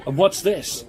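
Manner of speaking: excited mock commentary. Commentator spotting a contestant's surprise move, mounting excitement.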